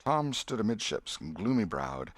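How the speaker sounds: ordinary delivery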